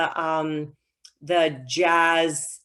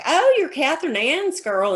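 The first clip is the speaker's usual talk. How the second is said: higher-pitched voice of a friendly druggist; small-town druggist; 7 semitones higher than usual. Retold or imitated. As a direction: greet her like the hometown druggist. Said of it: "druggist would like".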